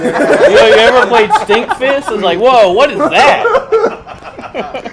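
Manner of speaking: high, laughing excitedly. Real, not acted. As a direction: laugh it out loudly. Laughing impression of song reaction.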